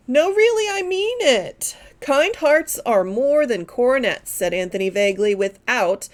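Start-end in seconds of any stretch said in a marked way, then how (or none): 0.1-1.7 gushing. A narrator's reading. Miss Taylor gushing.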